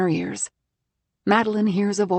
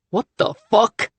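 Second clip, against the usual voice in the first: angry shock; 3 semitones higher, 6 dB louder than usual. Acted. Husband finds her hiding.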